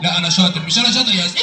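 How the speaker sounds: very low-pitched puppet character; Aragoz puppet. Acted. Puppet explaining his visit to the host, Aragoz voice. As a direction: play a comic puppet, far below your own pitch. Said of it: "الأراجوز ده وهو بيرغي".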